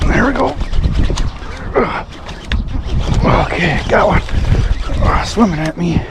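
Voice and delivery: high-pitched excitement while fighting a fish; real thrill, pitch lifted; excited. Real, not acted. Hooked fish, reeling it in.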